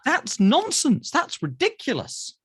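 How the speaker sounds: emphatic dismissal. Real, not acted. Scorning his own empty reassurance.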